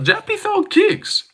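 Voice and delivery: squeaky mocking read of a chat message; mock the viewer's comment in a high silly voice.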